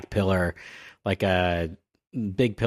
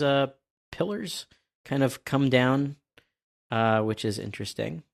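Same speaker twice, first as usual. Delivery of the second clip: ordinary delivery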